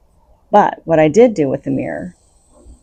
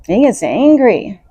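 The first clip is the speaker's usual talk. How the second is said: ordinary talk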